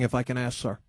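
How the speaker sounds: ordinary talk, nothing marked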